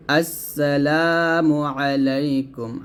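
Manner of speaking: আসসালামু আলাইকুম slow, লা stretched; model recitation. Shown as a demonstration. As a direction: say it slowly with a long লা. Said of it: "আমার সাথে বলেন". (ধীরে, লা টেনে)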